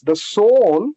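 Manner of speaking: ordinary delivery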